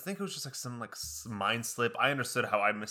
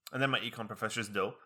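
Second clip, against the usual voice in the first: normal talking voice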